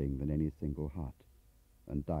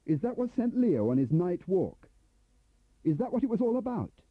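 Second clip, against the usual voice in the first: raised voice; 12 semitones higher than usual. Acted. Turner confronts Bradfield, rising pitch.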